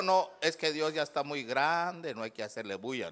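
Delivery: put-on low, soft old-man objection; subdued, dismissive grandfather voice. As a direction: mumble it low like a reluctant grandpa. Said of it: "como dijo mi abuelo".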